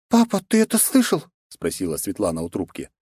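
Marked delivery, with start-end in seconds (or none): 0.1-1.2 put-on high, urgent young princess; young woman voice. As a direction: voice her as an alarmed young woman. Svetlana's voice. (встревоженная княжна)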